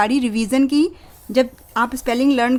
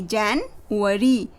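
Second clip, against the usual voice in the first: slow full word; 2 semitones lower, 3 dB quieter, much slower than usual. Shown as a demonstration. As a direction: say January slowly and fully. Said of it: "break हो सकता है".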